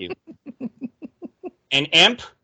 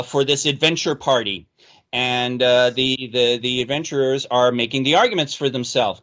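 ordinary talk, nothing marked

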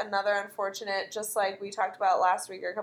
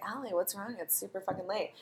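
softer, hushed mock worry; hushed late-night concern; 10 dB quieter, faster than usual. Fake Mariska catching Allie at night, hushed.

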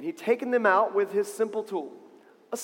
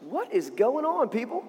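high, disbelieving questions; incredulous young hero; 7 semitones higher than usual. Acted. David addresses the frightened army, high-pitched incredulity. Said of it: "he's like".

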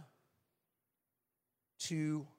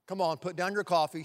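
ordinary delivery

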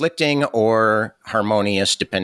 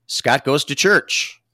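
announcer voice; 6 semitones higher, slower than usual. Acted. Announcing the segment title.

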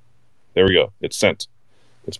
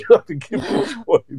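high barked drill command; drill sergeant; 10 semitones higher than usual. Pretend. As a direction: bark the order like boot camp. Repeats the drill sergeant line.

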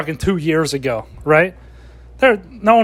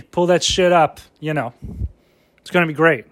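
usual speaking voice

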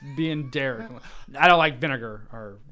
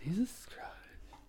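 breathless whisper; 3 semitones higher, 15 dB quieter, much slower than usual. Real, not acted. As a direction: whisper it, stunned. Echoing friend's exclamation.

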